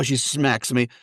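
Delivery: plain reading voice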